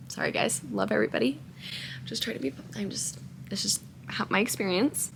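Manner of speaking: hushed, breathy apology to the viewers; whispered aside. Real, not acted. Whispering sorry to her viewers mid-rant, hushed. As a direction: drop to a whisper to excuse yourself.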